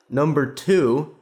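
high and slow, dragging each word; mock pompous question. Mocking the host's questions to a dead father, pompous. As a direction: ham up a smug lecturer listing questions. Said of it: "He goes, he goes".

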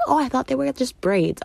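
high, oblivious sister voice; mock clueless relative; mimicking sister. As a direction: play her breezy and clueless. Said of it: "she's like".

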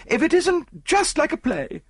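high, gushing wife showing off; excited society lady. Acted. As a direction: enthuse breathlessly about your treasure, pitched high.

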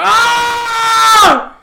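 very loud, high scream; peaking the mic. Shown as a demonstration. Comic peaking the mic with a scream. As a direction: blow out the mic on purpose. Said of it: "I'm gonna peek the mic. I'm gonna go".

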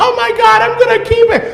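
very high squealed imitation; mimicked gushing woman. Man imitating her delight at keeping it, mock squeal. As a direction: gush it way up in pitch.

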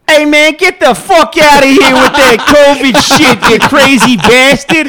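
loud, high, slow New Yorker yelling; New York accent. Retold or imitated. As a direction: belt it out like an angry New Yorker. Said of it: "do a New York accent".

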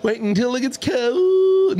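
mocking echo. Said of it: "mocking friend's words".

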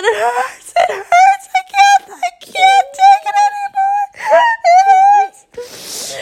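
extremely high, squealing mock agony; dying of laughter. Parents can't stop laughing, hammed-up agonized squeal. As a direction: squeal until the voice gives out to air. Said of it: "dying of laughter".

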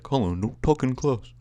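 high, loud mock dad voice; dad scolding. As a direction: imitate a father's token telling-off. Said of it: "My dad's gonna be like".

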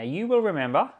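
ordinary talk

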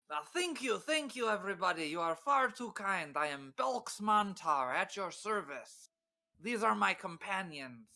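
high-pitched bard addressing an imagined big crowd; showman's flourish. Acted. Belks finishes singing to an imagined crowd, theatrical flourish. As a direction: thank the audience grandly, pitched well up. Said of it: "I do a flourish as if there is a big crowd".

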